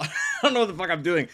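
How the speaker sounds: normal talking voice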